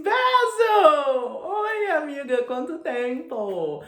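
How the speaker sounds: a high, slow, camp mock greeting to an old friend; campy Dorian greeting. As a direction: gush over an old friend in a high, drawn-out voice. Dorian greeting Basil campily.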